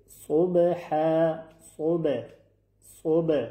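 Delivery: صبح with the ب released in a small bounce; صبح with a clear echo on the b; bouncing qalqala b. Shown as a demonstration. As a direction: pop the ب in صبح. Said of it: "حرف با قلقله".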